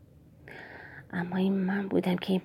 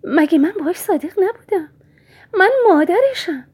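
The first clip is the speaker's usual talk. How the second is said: 1 indignant mother; 11 semitones higher, 6 dB louder than usual. Acted. Mother's angry retort.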